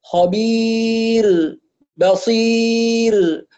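خبير and بصير drawn out very slow, final ra light and voiced with no air. Shown as a demonstration. Teacher modelling correct final ra. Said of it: "مجهور وليس مهموس".

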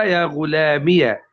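يا غلامية ending in "-miya"; ya with fatha. Shown as a demonstration. Teacher giving the fourth form, "-iya" ending on يا غلامية. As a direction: open the ya of يا غلامية with an "a". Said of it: "بفتح الياء".

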